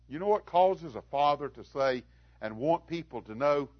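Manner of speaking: usual speaking voice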